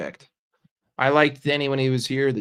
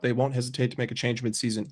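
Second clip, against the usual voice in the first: ordinary delivery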